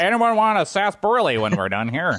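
lightly voiced old-west saloon keeper; old-timey voice. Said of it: "that old-timey voice".

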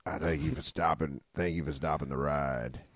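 very low, hushed thanks; mock humble gratitude. Caught man thanking his captors, mock humble. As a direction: play broken-down relief, low and soft.

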